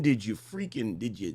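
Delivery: ordinary talk, nothing marked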